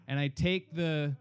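ordinary talk

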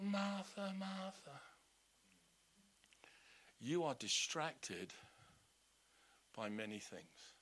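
soft, slow, gentle reply; gracious. Retold or imitated. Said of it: "Jesus is so gracious".

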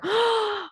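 high, sharp gasp of delight; excited gasp. Real, not acted. Hearing the waffle stuffing tip, a high gasp. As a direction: gasp at the great idea.

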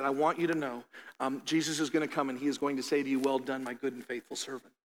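tender reassurance. Retold or imitated. Tender words to dying church lady.

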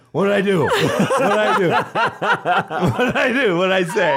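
imitating a high, flustered plea of innocence; bewildered protest. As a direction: act out someone baffled at being blamed. Performer in bunny scene, bewildered, retold.

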